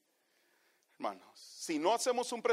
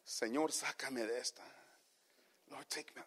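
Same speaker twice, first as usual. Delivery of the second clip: hushed mock prayer; 5 semitones lower, 12 dB quieter than usual. Mimics a desperate believer's prayer.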